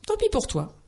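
fairy's retort. Acted. Fairy answers refusing enchanter.

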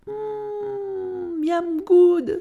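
long high savouring hum, then a yum; high-pitched relish, drawn out; delighted exclamation. A narrator's reading. Narrator plays Bou tasting the perfect soup, delighted. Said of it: "s'escria-t-elle".

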